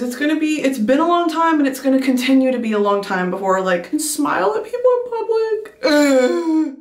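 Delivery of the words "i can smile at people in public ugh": tearful. Real, not acted. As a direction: voice breaking up high. Crying about missing people.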